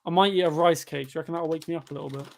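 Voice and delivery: usual speaking voice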